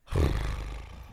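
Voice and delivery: deep horsey snort; horse impression. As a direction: blow out like a horse. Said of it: "they impersonate a horse".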